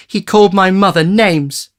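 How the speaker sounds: put-on high, hurt boy; high, indignant child defending mother; Oliver. A narrator's reading. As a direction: protest with wounded anger.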